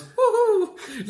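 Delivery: very high squeaky woohoo; cartoon liver cheering, very high. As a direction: squeal it like a tiny cheering organ. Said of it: "his liver goes".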